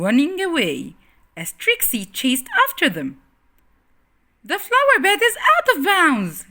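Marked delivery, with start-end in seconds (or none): 4.5-6.5 high loud shout; high loud playful shout; shouted rule. Acted. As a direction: call the rule across the yard. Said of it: "she shouted".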